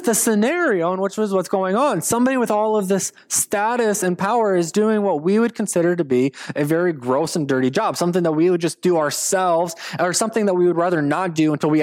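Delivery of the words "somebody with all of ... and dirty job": normal talking voice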